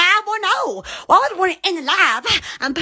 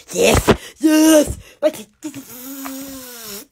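ordinary delivery

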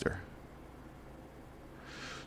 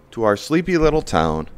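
ordinary talk

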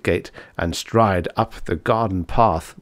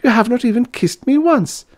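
very high female character voice; high, loud lovesick girl; plaintive young lady; 6 dB louder than usual. A narrator's reading. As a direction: wail reproachfully at your lover.

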